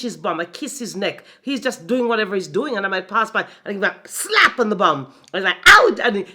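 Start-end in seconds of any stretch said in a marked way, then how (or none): 5.6-6.0 sudden high, loud teasing yelp; a loud playful yelp at him; playful shout. Retold or imitated. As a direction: bark it out like a cheeky tease. Playful slap on husband's bum.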